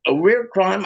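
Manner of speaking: usual speaking voice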